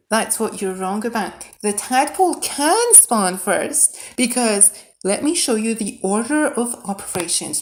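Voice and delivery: normal talking voice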